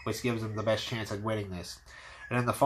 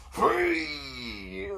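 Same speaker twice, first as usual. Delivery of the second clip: very high, crying shout; wild battle cry. Retold or imitated. Hunter leaps at the dragon with a high battle scream.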